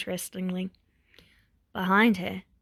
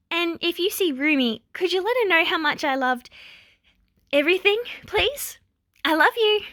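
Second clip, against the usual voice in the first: much higher, bright forced cheer; louder, high brave cheeriness; 8 semitones higher, 4 dB louder than usual. A narrator's reading. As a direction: sound cheerful over the tears. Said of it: "puts as much cheer into her voice".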